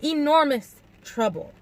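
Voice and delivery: slow, high stress on "Enormous"; drawn-out "Enormous", falling to "trouble"; emphatic warning. A narrator's reading. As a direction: lean hard on "Enormous", then drop on "trouble". Same threat, carried on emphatically.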